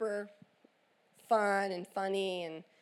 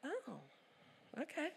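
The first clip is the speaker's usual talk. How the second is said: hushed; 5 semitones higher, 15 dB quieter than usual. Retold or imitated. Mom caught off guard, hushed.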